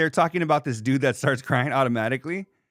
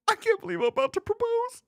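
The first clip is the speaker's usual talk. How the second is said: falsetto mock proposal, far higher; mocking proposer. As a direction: squeal it like a silly dork proposing.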